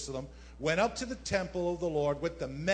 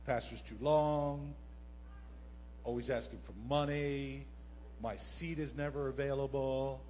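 mock complaining; 6 semitones lower, 6 dB quieter than usual. Voicing churchgoers' complaints.

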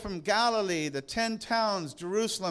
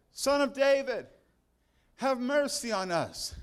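a raised plea that fades at the end; cried out; 4 semitones higher than usual. Acted. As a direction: shout it after him, pleading. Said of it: "when they cried out".

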